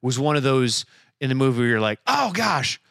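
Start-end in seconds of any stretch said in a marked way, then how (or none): 2.1-2.8 high-pitched startled exclamation; acted-out shock, pitched way up; shocked viewer. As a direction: gasp it out like a stunned moviegoer. Acts out a viewer's shocked reaction.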